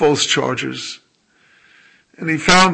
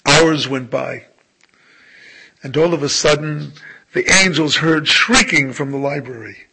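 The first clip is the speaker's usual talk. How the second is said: normal talking voice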